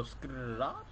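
rolled, drawn-out gun sound; imitated gun noise. Asking teammates about the gun, imitated rattle. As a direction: imitate a gun with a rolling rasp. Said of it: "Does the gun go".

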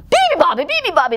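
high, loud roared chant; roaring. Acted. Animals keeping the bird awake, roaring. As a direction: roar it all together. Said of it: "they roared".

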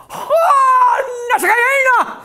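high, loud mock wail; hammed-up high-pitched outcry; mock Japanese golfer. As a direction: cry out like a caricatured Japanese golfer. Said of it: "when the Japanese guy goes".

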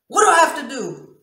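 very loud, high desperate demand; anguished yell. Retold or imitated. Woman demonstrating how she talked through pain, anguished yell. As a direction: burst out pleading at full voice. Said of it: "And I talk like that".

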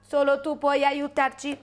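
monotone little alien, evenly spaced words; measured alien creature voice; Ioto alien. Acted. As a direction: speak on one steady note like an alien. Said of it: "parla un po' come ... uno Ioto".

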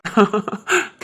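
short chuckle; amused chuckle. Acted. Husband hearing his wife's retort, short chuckle. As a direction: laugh off the retort.

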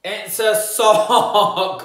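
slow, high, teasing reveal of the word; drawn-out reveal. Shown as a demonstration. Teacher slowly unveiling the sock to the class, drawn out. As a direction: stretch it out like a big reveal. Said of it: "What is that?".

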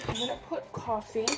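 ordinary talk

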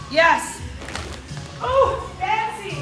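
normal talking voice

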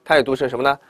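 ordinary talk, nothing marked

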